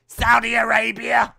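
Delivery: hammed-up loud reveal, slow; proud punchline. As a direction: slam the answer out like a triumphant reveal. Chat-up line punchline.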